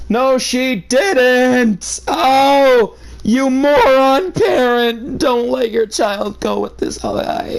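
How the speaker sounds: high, slow outraged shout; exasperated yell. Real, not acted. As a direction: scold the parent in disbelief. Reader yells at story parent.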